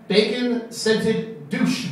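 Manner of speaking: each word drawn out slowly; deadpan drawn-out reveal; slow punchline. Real, not acted. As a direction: land the three words slowly. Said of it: "I got three words for you".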